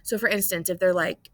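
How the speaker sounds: ordinary delivery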